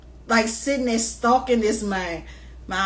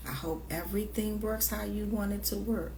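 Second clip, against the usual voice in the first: whispering; 11 dB quieter than usual. Real, not acted. Quiet sincere aside.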